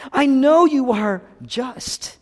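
high, loud, drawn-out angry cry; Jonah. Retold or imitated. Pastor voicing Jonah railing at God, angry shout. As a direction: stretch the words into an angry shout. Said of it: "Jonah's angry because he knows God".

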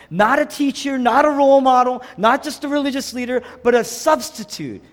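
high, loud, punched sermon phrases; forceful preaching. Real, not acted. Preacher telling the church Jesus is their substitute, forceful preaching. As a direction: drive each point home at full voice.

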